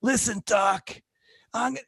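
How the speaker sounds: put-on high, raspy old lady voice; old patient voice. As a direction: play the feisty old patient. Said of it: "she goes".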